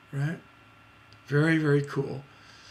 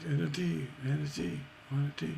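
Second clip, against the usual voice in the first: ordinary talk, nothing marked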